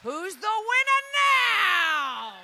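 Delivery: loud, slow victory cry; triumphant gloat. Acted. Woman gloating over the builders, triumphant. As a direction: crow it like a champion.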